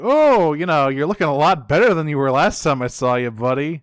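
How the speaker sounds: high, loud fake cheer; mock friendly. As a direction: gush over him with fake warmth. Retold smug villain greeting.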